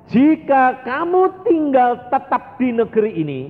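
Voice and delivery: high, ringing reading of God's word; scripture proclaimed. Acted. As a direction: proclaim the verse as God speaking. Reads scripture with pulpit force.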